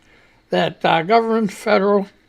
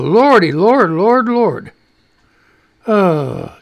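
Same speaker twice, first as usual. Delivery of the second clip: usual speaking voice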